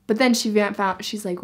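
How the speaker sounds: ordinary delivery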